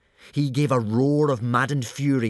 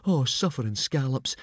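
dismayed Fishlegs; 4 semitones higher than usual. A narrator's reading. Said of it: "said Fishlegs, shutting his eyes".

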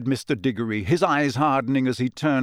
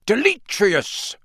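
shouted spell; 8 semitones higher, 5 dB louder, slower than usual. Acted. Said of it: "Mister Diggory shouted".

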